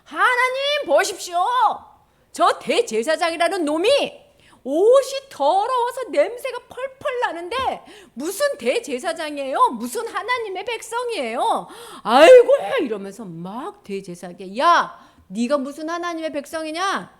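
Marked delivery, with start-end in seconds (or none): 0.0-12.5 loud, high-pitched outraged tattling; hammed-up scandalized accuser; accusing Satan. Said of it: "사탄이 그걸 보고 하나님". 14.6-17.1 loud, scornful telling-off; raised, heckling mock abuse; abusive scolding. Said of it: "막 이러면서 막 욕을 하는 거예요".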